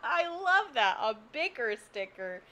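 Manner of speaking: high, loud burst of laughter; laughing. Real, not acted. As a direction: crack up, then laugh through it. Laughs at the "bicker sticker" joke.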